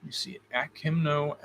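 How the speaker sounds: usual speaking voice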